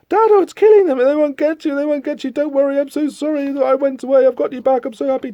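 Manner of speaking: high, gushing mock-dramatic voices; hammed-up breathless melodrama, pitched well up; frantic rescue. Dad comes to rescue his daughter, frantic.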